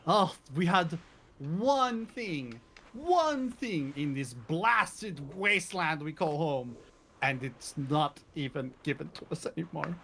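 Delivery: slow, whiny villager lament; complaining townsfolk. Acted. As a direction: moan like a fed-up local. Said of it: "you hear many times as you speak door to door".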